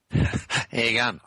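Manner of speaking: slow, put-on Australian accent greeting. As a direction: drawl it out like a mock Aussie. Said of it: "I was practicing my Australian".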